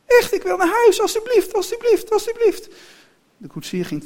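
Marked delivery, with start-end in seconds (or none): 0.1-2.7 very high, loud begging; pleading child. A narrator's reading. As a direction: beg desperately in a little girl's voice. Kind smeekt om naar huis. (heel hoog en luid smeken)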